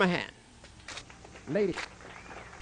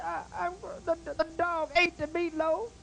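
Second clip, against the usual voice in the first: mock crying wife; 6 semitones higher, 6 dB quieter than usual. Wife telling husband about the meatloaf, mock crying.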